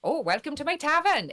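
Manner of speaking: cheery innkeeper. Retold or imitated. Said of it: "sort of like a".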